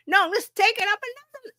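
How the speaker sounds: high, hyped imitation of a keen assistant; fired-up AI reply. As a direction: pitch it way up, playfully eager. Voices AI answering again.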